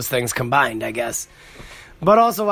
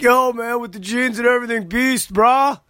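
put-on much higher, punchy hyped teen bro voice; hyped bro kid; 9 semitones higher than usual. A kid passing on the hill praises his jeans, hyped bro voice. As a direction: hype him up like a random kid on the trail.

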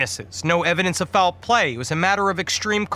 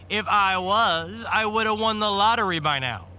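smirking; 4 semitones higher than usual. A narrator's reading. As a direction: play to the audience with a smirk. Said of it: "he smirked".

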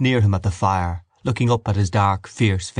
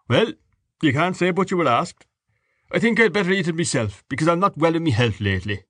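higher joking father voice; jovial Irish father; 8 semitones higher than usual. A narrator's reading. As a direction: tease the table as the host. Father jokes, eats it himself.

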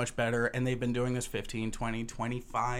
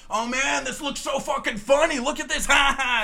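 much higher, louder, laughing fan voice; gushing fan; 11 semitones higher, 6 dB louder than usual. Acted. Comic artist imitating a delighted fan browsing his book. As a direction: play the thrilled browser at the table.

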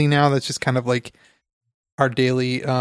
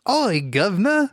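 higher, jokey Cockney "Oi, governor"; bad Cockney accent; 6 semitones higher than usual. Co-host greets the host in a mock Cockney accent. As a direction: throw out a hammy London Cockney greeting. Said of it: "I thought it was more of a southern".

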